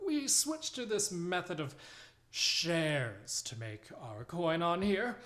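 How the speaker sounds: put-on nervous old sailor raising a grievance; older halfling voice. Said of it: "he says".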